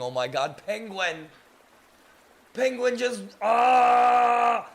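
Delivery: high, loud burst of amazement; overwhelmed, high-pitched exclaiming; excited shouting. Real, not acted. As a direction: cry out, building to a scream. Overwhelmed by Penguin's gift.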